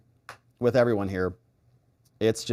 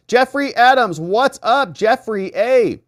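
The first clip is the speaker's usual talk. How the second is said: loud, higher greeting called out; excited shout-out; 8 semitones higher, 6 dB louder, slower than usual. Real, not acted. Streamer greeting a chatter by name, loud and excited.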